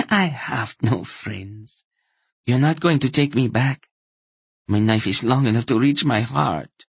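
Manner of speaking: high woman's voice, bitter and defiant; cornered woman's bitter defiance, pitched high; bitter laugh. A narrator's reading. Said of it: "She laughed bitterly at that".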